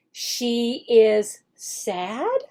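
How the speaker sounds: slow, rising question. Shown as a demonstration. Teacher guides the student to the answer, slow. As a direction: draw the child to the answer. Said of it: "teacher acts out the lesson".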